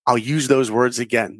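ordinary delivery

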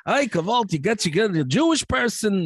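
high, singsong Yiddish guy; mock Yiddish accent. Shown as a demonstration. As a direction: put on a hammed-up Yiddish accent. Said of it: "I can do a Yiddish guy".

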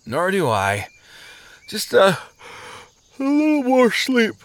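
sleepy words ending in a high yawn; drifting off. Acted. Sluggard nodding off while agreeing, yawning drowsily. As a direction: yawn through the last words.

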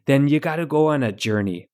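Midwestern mom giving folksy advice; Midwestern mom accent. Acted. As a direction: answer like an understanding Midwestern mom. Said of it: "answer as a Midwestern mom".

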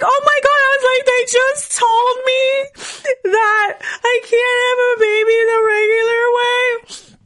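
very high, drawn-out tearful wail; real crying. Real, not acted. Patient telling naturopath her bad news, really crying. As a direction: squeeze the words out through sobs. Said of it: "I don't even know why I'm crying".

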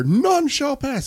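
high, drawn-out knightly proclamation; mock-heroic high call. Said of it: "all of a sudden I hear".

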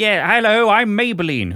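high, loud chirpy stranger voice; mock a nobody barging in; casual ghost intro. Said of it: "Who's that then?".

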